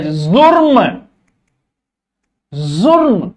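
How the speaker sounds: जुर्म with a buzzing z, ज़ुर्म; nukta sound. Shown as a demonstration. Teacher demonstrating nukta, जुर्म with clear z. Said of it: "जय पे जोर".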